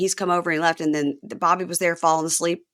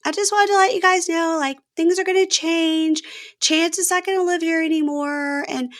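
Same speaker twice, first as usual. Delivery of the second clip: gently voiced mom; 9 semitones higher than usual. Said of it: "she goes".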